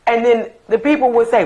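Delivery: ordinary delivery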